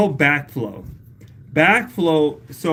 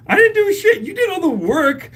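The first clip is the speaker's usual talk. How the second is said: excited protest; 14 semitones higher, 4 dB louder than usual. Real, not acted. Deflecting praise from Michelle.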